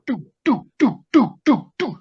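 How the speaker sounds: short, falling thud syllables; imitated footsteps. Creature's feet coming up behind him, imitated thuds. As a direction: sound out heavy steps, evenly spaced. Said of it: "and I hear this doo".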